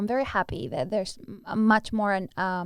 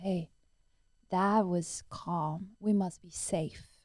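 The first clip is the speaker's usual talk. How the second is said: calm brain voice; 3 semitones lower, 7 dB quieter, slower than usual. Retold or imitated.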